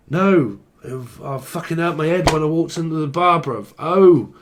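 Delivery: high, loud mock complaint; hurt Englishman. Englishman explaining his hurt head to a mate, mock-indignant. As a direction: play an aggrieved joke character.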